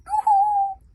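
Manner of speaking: very high, loud sing-song call; hogfly calling to Hiccup. Acted. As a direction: hail them cheerfully. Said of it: "the hogfly called back".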